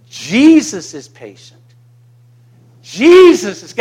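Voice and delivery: loud, ringing proclamation of praise; worshipful declaration. Real, not acted. Pastor proclaiming to the congregation, loud and fervent. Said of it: "do you hear my worship and saying it".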